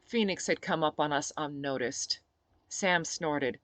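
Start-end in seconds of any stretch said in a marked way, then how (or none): none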